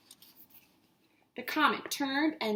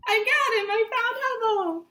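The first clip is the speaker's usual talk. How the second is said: high, loud happy cry; triumphant joy; 10 semitones higher, 6 dB louder than usual. Acted. Reader with kids catching Hubble, high and joyful. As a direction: celebrate the catch.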